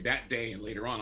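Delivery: normal talking voice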